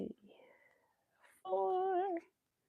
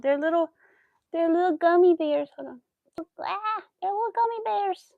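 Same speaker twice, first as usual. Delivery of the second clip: cutesy high voice; 9 semitones higher, 5 dB louder than usual. Real, not acted. Cooing over tiny gummy bears.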